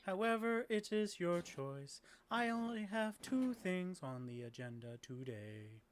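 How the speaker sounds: slow, monotone robot greeting; courteous automaton, word by word; polite robot quest-giver. Acted. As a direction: speak in held, stepped machine tones. Robot quest-giver Lawrence gives tasks.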